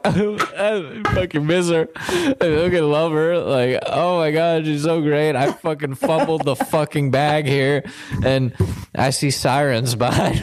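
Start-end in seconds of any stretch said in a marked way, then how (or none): 0.0-7.8 a higher, choked-up rant about missing her; tearful outburst. Retold or imitated. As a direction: cry it out, voice pitched up. Crying over his ex in car.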